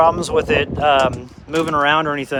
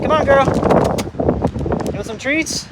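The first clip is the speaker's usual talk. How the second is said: calling the puppy; 9 semitones higher than usual. Real, not acted. Owner calling his puppy in with treats, high eager call. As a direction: tempt her with treats.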